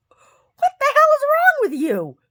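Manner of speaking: very high, loud, drawn-out disbelief; high incredulous yell; retold exasperated outburst. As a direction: cry out slowly in exaggerated exasperation. Retelling exasperated reply to neighbor.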